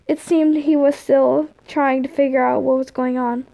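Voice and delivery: usual speaking voice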